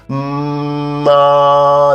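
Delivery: long steady hum; held hum. Shown as a demonstration. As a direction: hold the hum on one note. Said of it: "and then an r".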